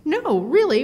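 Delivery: no, really, pushing back hard at friends; insistent protest. Retold or imitated. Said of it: "And I went".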